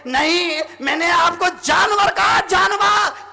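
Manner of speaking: high, loud acted insistence; emphatic protest. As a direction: insist as the distraught wife. Wife insists on her fault.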